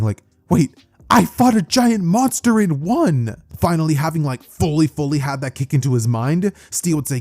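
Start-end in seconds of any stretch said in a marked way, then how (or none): 0.5-3.4 higher, slow dawning amazement; sudden realization. Acted. Boy realizing he beat a monster, amazed. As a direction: draw it out in rising disbelief. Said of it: "And Deku realizing".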